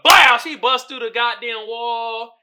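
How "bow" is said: shouted bang effect, much louder; a big cartoon hit sound; loud mock impact sound. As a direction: blurt out a punch noise. Imitated punch sound in story.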